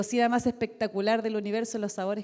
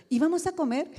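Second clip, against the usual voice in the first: higher-pitched retold eager question; pregunta ingenua imitada; 6 semitones higher, 4 dB louder than usual. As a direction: blurt it out like a naive student. Retells her eager question to theologian. (pregunta entusiasta e ingenua, recreada)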